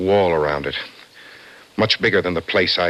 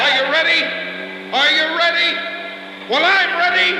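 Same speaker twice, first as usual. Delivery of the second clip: shouted threat. Acted. Kingman shouts his final threat at Bolton. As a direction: roar it before striking.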